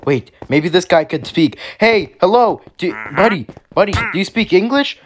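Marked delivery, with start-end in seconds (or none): 1.8-5.0 high, loud hail to a stranger; eager raised-pitch calling. Acted. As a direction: get the stranger's attention. Hails a stranger.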